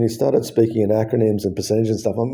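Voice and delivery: ordinary delivery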